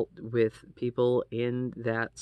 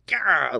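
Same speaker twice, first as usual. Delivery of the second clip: a sharp, loud, higher Gah of snapping; frustrated outburst; 6 semitones higher, 8 dB louder than usual. Retold or imitated. In the middle of an argument, a sudden loud frustrated Gah. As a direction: let it burst out like your brain just snapped. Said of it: "I was just like".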